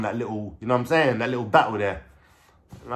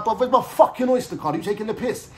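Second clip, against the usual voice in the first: high-pitched retold indignant challenge; high, exasperated mock demand; angry confrontation; 9 semitones higher than usual. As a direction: act out confronting someone, pitch shooting up. Acted confrontation at the door.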